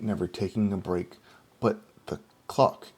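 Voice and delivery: usual speaking voice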